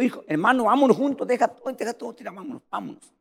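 a hint of an overjoyed brother begging; lightly voiced eager Esau. Esau embracing his brother.

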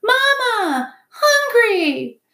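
very high, loud chick cries; piping little gosling calling its mother; peeping gosling. A narrator's reading. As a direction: peep it out like a hungry baby bird. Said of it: "Bright Bill started peeping".

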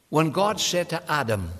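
usual speaking voice

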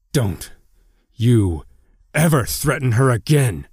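halting words building to an angry snarl; fierce warning, rising in force. A narrator's reading. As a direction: bite out each word as a threat. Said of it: "Link snarled".

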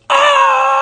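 long, high, loud scream; imitated scream. Retelling his run up to the fire, loud scream. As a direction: scream one sustained high "ah". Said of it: "went up to the fire screaming".

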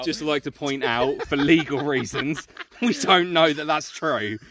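mock legal disclaimer.

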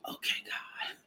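breathless whispered surrender; awed whisper. Retold or imitated. Surrendering to God in a stunned whisper. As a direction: whisper "Okay, God" in disbelief. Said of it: "And I was like".

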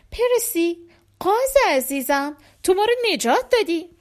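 high, joyful praise for a pet; happy. Acted. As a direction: gush with grateful delight. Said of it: "با خوشحالی به طرف پرسی رفت".

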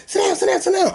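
mock high-pitched holler; yelling bus driver. Bus driver ordering a passenger to sit, mock yell. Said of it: "gonna fucking yell at me talking about".

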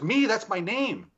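high, slow, emphatic mimicry; imitated excited woman. Investigator retells woman recognising her name, imitated excitement. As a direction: draw it out high, as her astonished claim.

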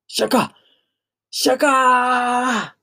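very high, loud wailing of the name; anguished scream. Acted. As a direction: cry out to the sky in grief. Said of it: "空に向かって大声で叫んだ".

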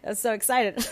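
breaking into high laughter; laughing. Real, not acted. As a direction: laugh it off, giddy. Laughing after choking fit.

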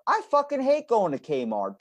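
high, vehement outburst; exasperated, high-pitched declaration of hatred; emphatic loathing. Acted. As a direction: blurt out the honest truth, pitched right up. Blunt truth against the faked line.

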